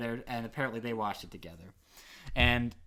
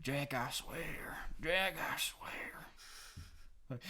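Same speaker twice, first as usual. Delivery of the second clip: breathy slow movie quote; 5 dB quieter, much slower than usual. Retold or imitated. Quoting movie line.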